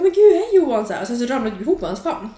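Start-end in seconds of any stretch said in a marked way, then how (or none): 0.0-0.9 high, loud mock-gushing hello; excited greeting. Greeting Johan on the boat, excited high call. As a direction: call out excitedly, pitch jumping up.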